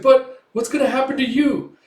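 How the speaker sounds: high, loud anxious question; acted alarm for someone; worried outcry. Fleeing man fears for his ally, worried cry.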